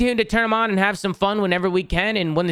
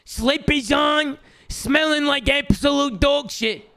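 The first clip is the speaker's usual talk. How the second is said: sports commentary; 7 semitones higher than usual. Acted. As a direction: hype the putt like a TV commentator. Mock putt commentary.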